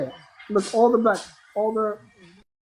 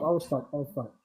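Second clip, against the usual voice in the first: repeats trailing off, much softer; fading quieter; 6 semitones lower, 10 dB quieter than usual. Real, not acted. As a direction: let each repeat drop away.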